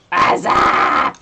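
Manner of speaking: hoarse whisper-shout greeting; loud breathy whisper. Pretend.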